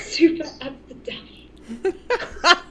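put-on slow, laughing posh British accent. Laughing at the show title, slow posh British. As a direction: savour it slowly like a fancy Brit. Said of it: "I like all these".

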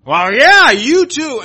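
high, loud sarcastic retort; imagine her biting back; mock snap back. Imagined woman snapping back.

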